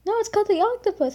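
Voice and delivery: quick insistent retort; mock arguing mom. Mom correcting the ride's name, lightly imitated insistent. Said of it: "she kind of argues".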